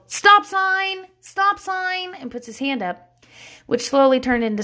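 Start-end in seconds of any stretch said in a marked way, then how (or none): 0.1-2.1 put-on high-pitched little-kid call; mock yell in a high child's voice; imitating toddler's yell. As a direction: mimic a small child calling out. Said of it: "he yelled".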